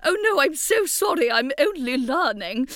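put-on plummy English accent, sorry I'm only learning; posh English accent. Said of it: "ridiculous Maggie Smith accent".

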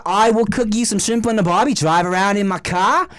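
hammed-up Aussie accent; exaggerated Australian bloke. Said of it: "weird accent you're doing".

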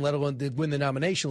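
normal talking voice

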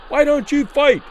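loud, high angry crowd yell; furious heckling question; wrathful demand. A narrator's reading. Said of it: "it demanded wrathfully of Rivera".

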